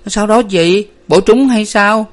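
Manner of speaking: high, alarmed questions; stammering worry. A narrator's reading. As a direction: ask your hurt sister in alarm. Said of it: "Hà lắp bắp hỏi".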